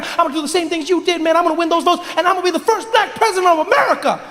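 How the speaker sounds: very high, fast, squeaky fanboy gush; over-excited young Barack. Acted. Barack meets his idol Mandela, high, frantic excitement. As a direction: race breathlessly through it in a high-pitched voice. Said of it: "Not with that voice".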